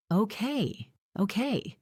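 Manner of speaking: shaky frightened okay; scared man at gunpoint, quivering. A narrator's reading. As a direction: back down with a tremble. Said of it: "with a quiver in his voice".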